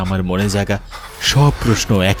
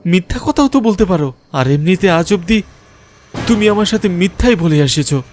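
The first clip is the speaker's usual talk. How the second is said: high-pitched, heated accusing outburst; agitated accusation; 10 semitones higher than usual. Acted. Man accusing the ghost girl of endless lies, agitated. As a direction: protest in a raised, much higher voice.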